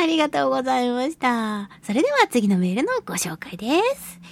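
ordinary talk, nothing marked

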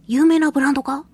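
normal talking voice

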